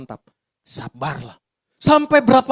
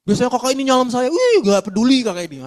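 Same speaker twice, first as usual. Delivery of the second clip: louder, quick hurt grumble; mock offended; 6 semitones higher, 6 dB louder than usual. A church member grumbling about being ignored, mock offended. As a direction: act out the sulky thought of being ignored.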